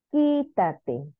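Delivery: quítate with a drawn-out QUÍ; teacher sounding out quítate, QUÍ stressed; stress on antepenultimate syllable. Shown as a demonstration. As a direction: stretch QUÍ at the start of quítate. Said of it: "Tercera sílaba. Antepenúltima".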